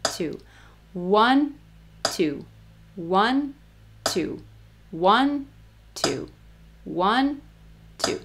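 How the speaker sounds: accent each One; counting along with an accented downbeat; stress on One. Shown as a demonstration. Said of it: "We always emphasize one".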